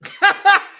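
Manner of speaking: sudden high shrieking laugh; loud burst of laughter. Real, not acted. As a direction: crack up out loud.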